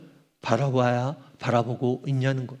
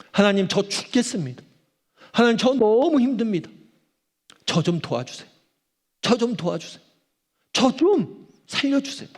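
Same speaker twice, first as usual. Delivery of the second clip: rising, pleading cries, higher and higher to a high final plea; desperate cry to God; 6 semitones higher than usual. Pretend. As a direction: beg God for rescue, voice climbing. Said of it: "그렇게 외치는 게 기도예요".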